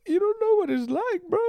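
very high squeaky whine; squeaky mock whine. Teasing a friend again, squeaky falsetto whine. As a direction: push it way up into a squeak. Repeat, even higher.

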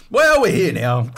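mock-cheeky invader; smug gloat. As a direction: gloat about having already arrived.

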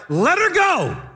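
high, loud barked order; a stern healer's command, full voice; commanding shout. Retold or imitated. Wigglesworth to the two ladies, a loud commanding shout.

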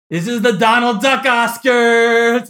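loud, slow, high hype; excited. Real, not acted. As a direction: shout it like big news. Hyping the Donald Duck Oscars.

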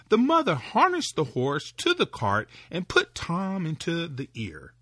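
plain reading voice

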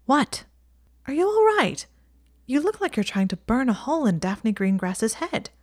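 a friend snapping her out of a stare; Ginny concerned. A narrator's reading.

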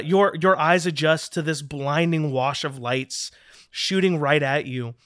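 usual speaking voice

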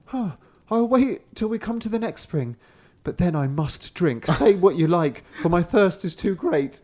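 brother's voice. A narrator's reading. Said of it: "The brother did not drink and said".

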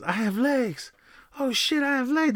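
high-pitched astonished cry; mock amazement. Fake beggar suddenly finding he has legs, mock amazement. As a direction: exclaim it as a sudden miraculous discovery.

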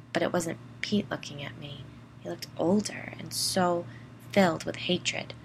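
ordinary delivery

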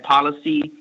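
ordinary talk, nothing marked

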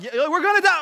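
loud yell over headphones. Retold or imitated. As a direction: yell it like you can't hear yourself. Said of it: "yelling like that".

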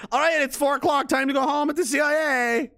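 lightly mocked end-of-shift call; mock quitting-time announcement. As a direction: pretend to cheerfully call time on the workday. Mocks staff clocking off at four.

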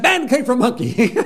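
put-on a cheeky boy taunting grandma; defiant child. Little boy defying his grandmother in a high child voice.

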